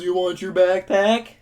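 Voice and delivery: mock taunt from the hilltop. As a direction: dangle it like bait. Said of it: "decides to taunt me".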